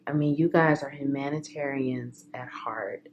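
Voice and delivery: soft whispered praise; tender whisper. Real, not acted. Reader moved, whispering praise to viewers. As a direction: whisper it like a heartfelt secret.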